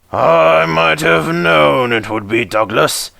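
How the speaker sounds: high, slow exasperated boss; resigned to the culprit; weary controller. Acted. Fat controller sighing.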